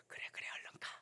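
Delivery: breathless whisper, go on now; whispered urging. Retold or imitated. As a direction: hush her off to the customer. Pastor urging her on in a whisper.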